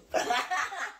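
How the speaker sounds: high burst of laughter; high, open cackle of amusement; amused laugh. Real, not acted. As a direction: laugh out at your own act. Laughs at her own pretend sulk.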